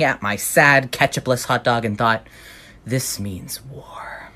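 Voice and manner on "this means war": slow, whispered declaration of war; whispered vow. Acted. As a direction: hiss it slowly like a dark oath. Vowing revenge over lost ketchup.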